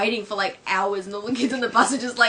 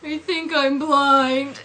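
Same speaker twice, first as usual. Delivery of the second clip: slow, loud cartoon husband; slow, dopey husband's reply; Homer; 6 semitones higher, 7 dB louder, much slower than usual. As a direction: draw it out high and loud. Homer answers Marge.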